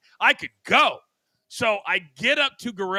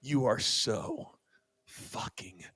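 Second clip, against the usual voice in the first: slow, soft whisper breaking into a laugh; whispered insult; 7 semitones lower, 9 dB quieter, much slower than usual. Retold or imitated. Boss dripping Red Bull quietly telling coach off, slow whisper. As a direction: hiss it barely audible, dead still. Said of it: "and I quote".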